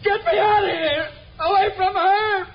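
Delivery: high, frantic screams for help; terrified screaming. Acted. Gibbering coward screams to be freed from her. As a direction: shriek as if trapped with a ghost. Killer panics, calls for help.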